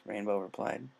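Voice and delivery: ordinary delivery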